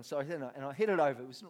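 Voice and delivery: ordinary delivery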